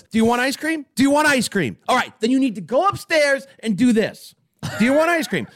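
put-on half-desperate mom bribing the kids; frazzled parent. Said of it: "every time I call, it's".

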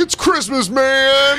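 over-the-top festive outburst; excited holiday cheer. Acted. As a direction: belt it out like a giddy reveller. Acted quote of an excited man.

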